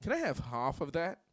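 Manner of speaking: put-on polite standard American accent, "half" said hahf; mock prim standard American accent.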